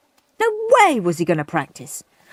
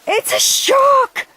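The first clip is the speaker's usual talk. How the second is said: high, loud, slow shriek; terrified scream; 5 dB louder, slower than usual. A narrator's reading. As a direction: scream the alarm. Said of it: "screamed Sour Susan".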